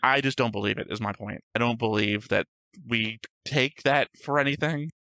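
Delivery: normal talking voice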